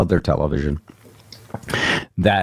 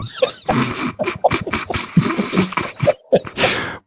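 burst of laughter. Real, not acted. Laughs at host's glasses.

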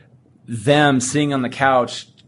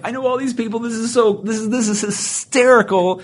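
delighted reaction; 11 semitones higher than usual. Retold or imitated. Own delighted reaction retold.

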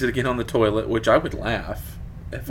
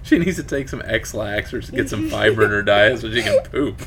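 ordinary delivery

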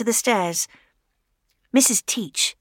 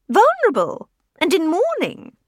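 put-on old lady voice; 9 semitones higher, 4 dB louder than usual. Widow explaining to Fay, high fretful old-lady voice. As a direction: sound like a fretting old widow.

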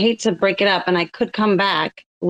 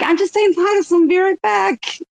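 high-pitched mock call to a parting companion; 11 semitones higher than usual. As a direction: toss out a bright, high "be right back". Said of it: "walk around the corner and be like".

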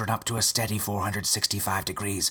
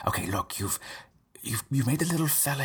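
ordinary talk

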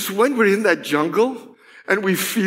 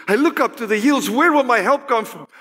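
higher, quicker, driving recitation; confident psalm reading; 7 semitones higher, faster than usual. Acted. Preacher who has found her vision, confident. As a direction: declare it with pitch lifted and no pause.

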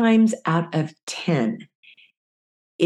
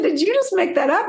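much higher, laughing question; laughing disbelief; 13 semitones higher than usual. Retold or imitated. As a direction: ask through laughter, amazed. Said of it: "And I said".

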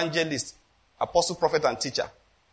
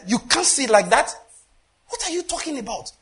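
incredulous retort; 8 semitones higher than usual. Retold or imitated. Retelling an argument, acting himself.

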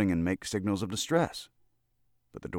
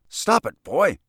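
much higher, louder alarmed cry; 10 semitones higher, 8 dB louder, slower than usual. Acted. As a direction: shout it in sudden panic. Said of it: "he cried in alarm".

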